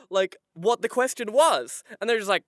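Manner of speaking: usual speaking voice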